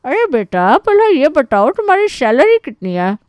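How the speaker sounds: high, loud, nosy aunt voice; interrupting aunt. Acted. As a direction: jump in as a pushy aunt interrogating a suitor. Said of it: "बुआ जी बीच में कूद पड़ती है". (बीच में टोकती बुआ की तेज़, ऊँची आवाज़)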